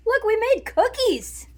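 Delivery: high, slow, cheery exclamation; excited. Real, not acted. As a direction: show them off proudly.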